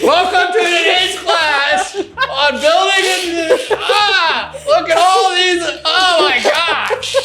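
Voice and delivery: high, slow, laughing impression of an eager teacher; mock instructor. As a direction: play the gushing class leader, laughing. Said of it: "your professor was like".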